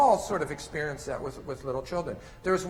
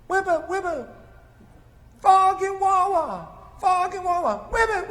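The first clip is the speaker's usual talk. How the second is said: put-on high toddler babble, much higher and louder; 6 dB louder than usual. Toddler in bed repeating frog play, high babbling voice. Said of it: "the child up in bed".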